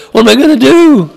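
a lightly acted panic; mock panic. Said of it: "at that point of".

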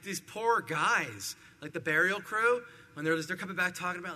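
normal talking voice

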